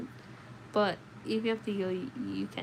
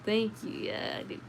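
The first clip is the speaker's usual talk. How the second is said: ordinary delivery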